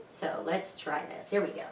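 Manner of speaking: usual speaking voice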